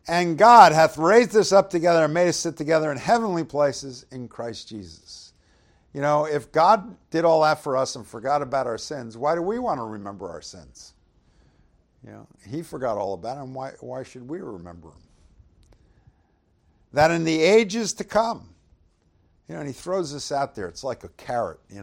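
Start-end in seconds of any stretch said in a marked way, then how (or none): 0.1-5.3 announcing verse six from the pulpit, ringing; scripture reading. Acted. 16.9-18.5 higher and loud opening of the verse; reading to the congregation, proclaiming; scripture reading. Acted. Reads verse seven.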